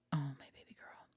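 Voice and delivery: whispered endearment to a pet; tender whisper. Real, not acted. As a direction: breathe it lovingly to the dog. Talking to her dog.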